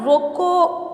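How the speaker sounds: "روکو" high and held out, then a stop; pause after روکو. Shown as a demonstration. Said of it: "روکو کے بعد سکتہ لگانے سے".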